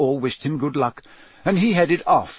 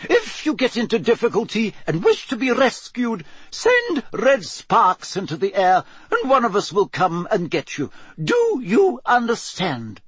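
high, deliberate teacher voice; professor instructing; 9 semitones higher than usual. Acted. Professor tells champions how to get rescued, high firm instruction. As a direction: spell out the safety rule, pitched high.

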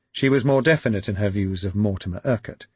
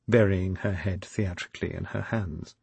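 usual speaking voice

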